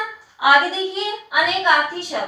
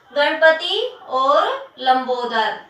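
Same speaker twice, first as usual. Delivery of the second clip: slow dictation; much slower than usual. Shown as a demonstration. Teacher repeats dictated words.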